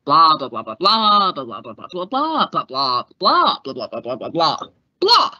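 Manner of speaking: mock droning chatter; mocking blah blah. Stenographer mocking endless talk, sing-song blahs. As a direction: rattle off the blahs like bored chatter.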